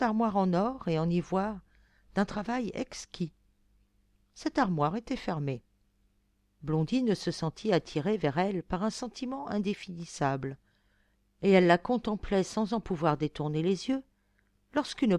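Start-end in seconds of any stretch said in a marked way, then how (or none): none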